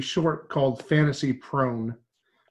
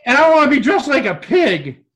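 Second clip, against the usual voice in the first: high, loud, excited demand; raised voice, giddy insistence; comic insistence; 8 semitones higher, 7 dB louder than usual. Real, not acted. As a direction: declare it with silly fervor.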